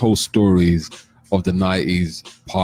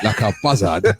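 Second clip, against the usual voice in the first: laughing; 2 semitones higher than usual. Real, not acted. Laughing at a joke.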